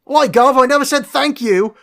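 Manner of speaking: high, loud mock cockney Gary Oldman; terrible cockney Gary Oldman. Mocking the film's cop line in a bad cockney. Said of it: "'cause his accent's fucking terrible".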